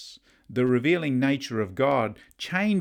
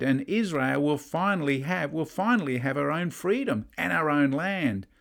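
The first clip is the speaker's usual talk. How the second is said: higher-pitched, cheering enthusiasm; delighted Moses; 4 semitones higher than usual. Acted. Moses enjoying what he hears from God, excited. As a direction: cheer God on with rising gusto. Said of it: "enjoying what he's actually hearing from God".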